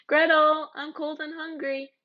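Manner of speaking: high whiny complaint; whining. Acted. Hansel whines to Gretel in the cold forest. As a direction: whimper to your sister.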